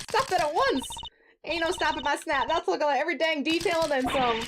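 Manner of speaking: high, twangy Southern reporter voice; Southern accent. Acted. Photographer catching a prosecutor red-handed, in a Southern accent. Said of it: "maybe that's the one accent I can actually do".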